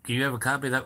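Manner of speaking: usual speaking voice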